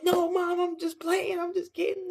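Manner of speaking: very high, whiny mock plea; panicked pleading. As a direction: beg mom in a squeaky, hammed-up panic. Retold panicked plea to mom.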